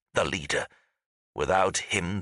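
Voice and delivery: plain reading voice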